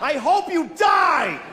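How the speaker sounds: high, slow mock shout; road rage yell. Driver cursing someone who drifted, high mock shout. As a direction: hurl it at another driver. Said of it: "I said that to a person".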